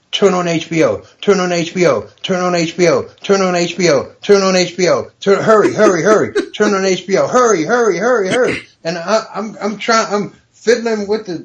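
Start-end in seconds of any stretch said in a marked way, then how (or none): 0.1-8.7 higher, insistent rapid-fire repeats; urgent. Retold or imitated. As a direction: nag him urgently to hurry up. Acting friend's urgent phone call.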